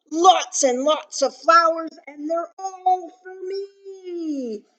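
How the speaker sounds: high, gleeful greedy bee; buzzed. Acted. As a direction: gloat loudly, stretching out the end. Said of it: "he buzzed".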